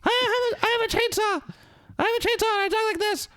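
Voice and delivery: very high, squeaky mock chainsaw maniac; hammed-up high maniac voice; chainsaw scare actor. Shown as a demonstration. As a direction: squeal it like a cartoon chainsaw guy. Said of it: "the other one".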